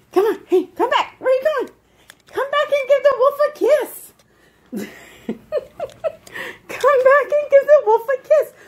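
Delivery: very high, squeaky dog-talk; high-pitched pet voice; coaxing pet talk. Real, not acted. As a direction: urge the dog on in a high, playful voice.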